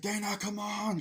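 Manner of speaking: high-pitched retold hero calling out; hammed-up urgent beckoning; mock urgent call. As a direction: imitate a dad urging his daughter on. Hero calls daughter to jump.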